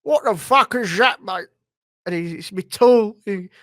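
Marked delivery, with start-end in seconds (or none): 0.0-1.5 loud, raised London gangster voice; Kray Twins. Acted. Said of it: "fucking the Kray Twins". 2.4-3.2 loud, lightly acted London gangster; Kray Twins. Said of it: "fucking the Kray Twins".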